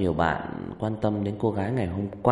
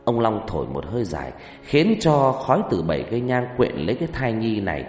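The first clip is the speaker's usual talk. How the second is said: story narration; 4 dB louder than usual. A narrator's reading.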